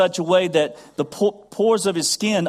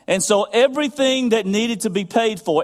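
ordinary talk

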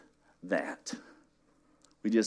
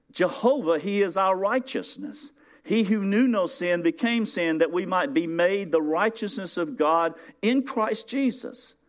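high, ringing, emphatic preaching; full force preaching; 8 semitones higher than usual. Acted. Pastor rousing congregation about righteousness, high fervent preaching. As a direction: drive every phrase home to the crowd.

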